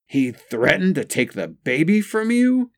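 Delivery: high, mildly appalled question; hoarse shock. A narrator's reading. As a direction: ask it in hoarse, high disbelief. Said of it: "her voice hoarse".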